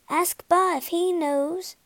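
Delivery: slow, high cooing voice; cooing pigeon. Acted. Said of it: "they cooed".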